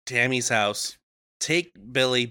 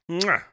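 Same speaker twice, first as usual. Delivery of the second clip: blowing an air kiss; 5 semitones higher than usual. Retold or imitated. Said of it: "give it a little smooch".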